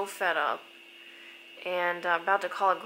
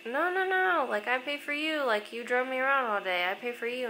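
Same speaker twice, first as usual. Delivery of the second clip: higher, re-enacted friendly objection; insistent protest; 5 semitones higher than usual. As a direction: insist on treating the other person. Retold friendly quarrel over paying.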